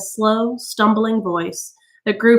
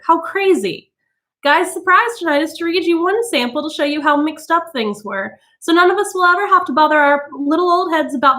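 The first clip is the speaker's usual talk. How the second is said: high nervous hostess speech; quavery hostess; 7 semitones higher than usual. A narrator's reading. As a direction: cover the crisis with brittle cheer. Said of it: "in a quavery voice".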